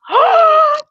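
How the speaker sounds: high, loud theatrical gasp; mock gasp. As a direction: gasp in pretend shock. Mock shock at male guest.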